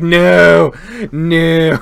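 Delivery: loud, drawn-out mock refusal; mock protest. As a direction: wail a long, loud no as the crowd. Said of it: "Everyone's like".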